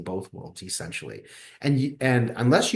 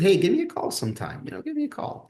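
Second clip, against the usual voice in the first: friendly invite; 10 semitones higher, faster than usual. Retold or imitated. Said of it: "he said".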